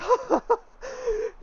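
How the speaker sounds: high breathless elated laugh; giddy laugh. Acted. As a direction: laugh out of pure rapture. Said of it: "and she's like".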